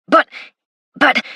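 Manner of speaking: stammered "But, but"; stammering. Acted. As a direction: stumble over "but" twice, flustered. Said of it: "stammered the man".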